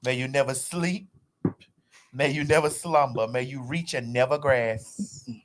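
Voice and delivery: laying a curse. Pretend. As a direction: intone it like a dark spell. Solemn curse on someone.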